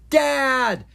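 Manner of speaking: high, loud drawn-out cry; shouting. A narrator's reading. Young dragons hearing their other parent outside, shouting. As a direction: shout out in startled recognition. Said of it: "Ella and Owen".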